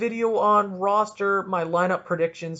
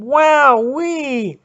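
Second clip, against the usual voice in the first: excited; 8 semitones higher, 9 dB louder than usual. Real, not acted. Proud of longest podcast.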